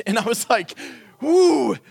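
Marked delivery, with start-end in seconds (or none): 1.2-1.8 wincing ooh. Retold or imitated. Neighbor demanded an adult.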